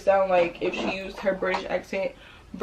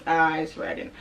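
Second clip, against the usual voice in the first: normal talking voice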